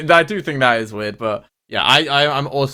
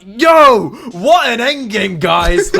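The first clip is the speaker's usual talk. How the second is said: high, loud, drawn-out hype shout; a high, loud mock hype over a weak endgame; hyped gamer call; 9 semitones higher, 6 dB louder, much slower than usual. Imitating a teammate loudly hyping his own endgame. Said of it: "And he's like".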